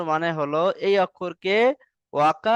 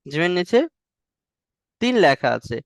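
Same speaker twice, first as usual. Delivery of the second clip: usual speaking voice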